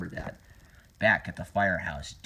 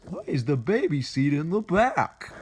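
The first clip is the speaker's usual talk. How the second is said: put-on higher questioning voice; high cartoon voice; 6 semitones higher than usual. Tunnel Rat asking about the baby seat, high cartoon voice. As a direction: ask it in a raised character voice.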